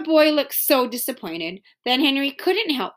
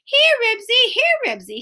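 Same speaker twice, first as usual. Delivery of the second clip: high dog call, easing down; 12 semitones higher, 4 dB louder than usual. A narrator's reading. The boy tries Henry's name for the dog, calling out. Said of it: "he called".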